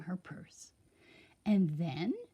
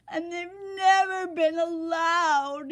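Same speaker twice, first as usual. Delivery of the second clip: high, louder sobbing; sobbing king; 9 semitones higher, 6 dB louder than usual. A narrator's reading. The sobbing king explaining the rules, high sob. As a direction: choke it out between sobs. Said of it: "he sobbed".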